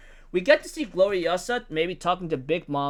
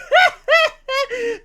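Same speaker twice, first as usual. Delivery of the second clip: laugh; 8 dB louder than usual. Real, not acted. Laughs at Roxy's line.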